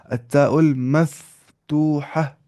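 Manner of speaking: drawn-out syllables, a pause within; slow, measured dictation; slow model pronunciation. Shown as a demonstration. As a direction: say it slowly for learners to copy. Term modelled slowly after question.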